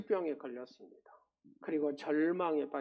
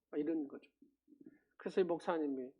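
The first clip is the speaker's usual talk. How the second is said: ordinary talk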